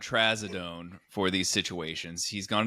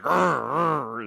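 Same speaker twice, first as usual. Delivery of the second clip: small dog growling, lightly louder; imitated dog growl; 4 semitones higher, 4 dB louder than usual. Dog resisting the thermometer, small mock growl.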